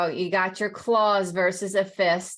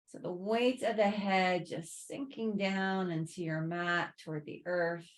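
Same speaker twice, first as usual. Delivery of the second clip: guided relaxation; 7 dB quieter than usual. Real, not acted. Yoga teacher guiding students to sink into the earth, soothing guided relaxation. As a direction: ease them gently down, unhurried and quieter. Guides a body scan lying down.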